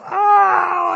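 high, loud groan of tooth pain; pained cry. Retold or imitated. Retelling his toothache agony.

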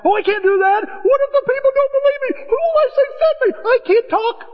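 high, pleading excuse-maker voice; whiny Moses. Acted. Moses begging God to send someone else, high and whiny. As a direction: squeal out every excuse to God.

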